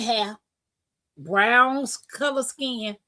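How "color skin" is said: usual speaking voice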